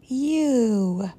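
lightly acted yelp, drawn out; a small character's modest yell; mild yell. A narrator's reading. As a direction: cry it out, but keep it contained. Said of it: "yelled Tom".

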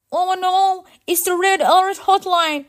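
high, loud alarmed robot; high-pitched robot panic; panicked. Acted. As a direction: cry out as the emergency line rings. Robot panics at emergency phone.

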